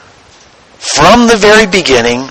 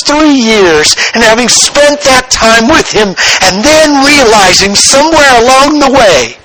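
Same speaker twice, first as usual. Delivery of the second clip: higher, rising preacher's build; pitched-up impassioned sermon climb; fervent preaching; 8 semitones higher than usual. Acted. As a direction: lift the congregation with mounting fervour.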